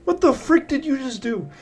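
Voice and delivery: high, startled what-the-heck; shocked outburst. Real, not acted. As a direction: blurt it out in disbelief. Shocked at teammate's move.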